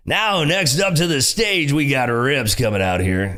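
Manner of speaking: slow, drawn-out club announcer voice; strip club DJ. Acted. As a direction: call the next act up to the stage like a DJ. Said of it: "he's got a strip club D J voice".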